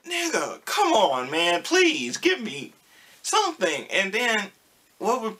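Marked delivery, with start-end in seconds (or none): 0.1-3.9 exasperated plea. Retold or imitated. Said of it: "And I'll just be like".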